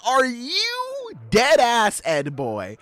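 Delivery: high, incredulous rant, stretched words; furious Roger. Retold or imitated. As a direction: chew him out in disbelief. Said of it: "Roger is furious".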